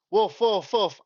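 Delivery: high quick woof barks; dog barking. Shown as a demonstration. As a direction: bark again like a dog. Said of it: "Let me redo it all over again".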